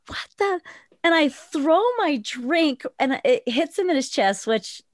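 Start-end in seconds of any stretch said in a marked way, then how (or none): 0.1-0.6 gasp, then high soft disbelief; high-pitched retold startle; startled. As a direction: act out a sudden shock with a gasp. Startled as man charges at her.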